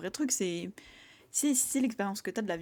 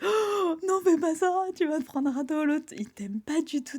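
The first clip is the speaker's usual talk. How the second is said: high, loud cry of dismay; alarmed warning; 8 semitones higher, 5 dB louder, slower than usual. Retold or imitated. A reader warning the character about the rejection, high alarmed cry. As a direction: yelp at the character not to do it.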